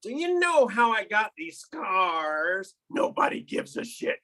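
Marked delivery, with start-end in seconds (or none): 0.0-2.7 slow, higher Joker taunt; a hint of Joker menace; lightly voiced Joker. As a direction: tease him like the Joker. Playing Joker.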